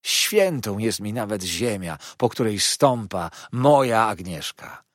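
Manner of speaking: loud, effusive outpouring; fawning, impassioned Heep. Acted. Heep confessing his love for Agnes, gushing. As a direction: confess adoration with servile fervour.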